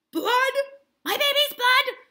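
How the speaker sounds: very high, loud shriek of horror; horrified mother. Acted. Mrs Putnam horrified to hear of her baby's blood, high and loud. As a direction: shriek it in disbelief.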